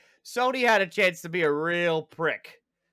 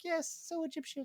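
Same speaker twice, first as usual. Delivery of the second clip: high, quick, soft British voice; put-on British reply, pitched way up; mock British accent; 8 dB quieter, faster than usual. Suit answering its surprised wearer, mock British accent. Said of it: "gonna have a British accent".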